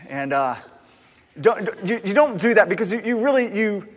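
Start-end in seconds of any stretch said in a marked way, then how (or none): none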